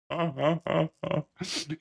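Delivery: quiet delighted chuckle; soft gleeful laugh. Real, not acted. Streamer delighted by his win, soft chuckle. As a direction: laugh softly to yourself.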